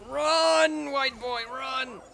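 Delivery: high, drawn-out call to run; mock urgent cry. Player cheers own getaway, high mock cry. Calling out mock warning during escape.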